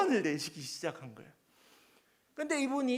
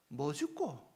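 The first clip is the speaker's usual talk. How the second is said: "뭐 죽고?" said in Busan dialect, a bit low and soft; 5 semitones lower, 5 dB quieter, slower than usual. Retold or imitated. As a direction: toss off "뭐 죽고?" the Busan way. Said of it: "부산 사투리로".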